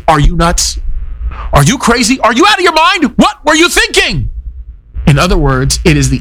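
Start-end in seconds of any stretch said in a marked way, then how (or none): 0.1-4.3 climbing, higher and higher disbelief; exasperated rhetorical questions rising in pitch; incredulous rhetorical questions. Retold or imitated. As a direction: build the disbelief with each question. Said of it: "most common rhetorical questions".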